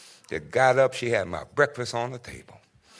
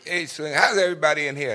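higher, louder announcing greeting; imitating Ace's call; 6 semitones higher, 5 dB louder than usual. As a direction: call out to the whole room. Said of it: "Ace was announcing his presence".

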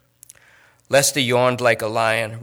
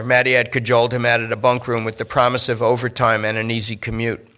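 plain reading voice

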